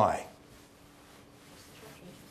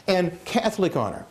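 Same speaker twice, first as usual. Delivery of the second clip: ordinary talk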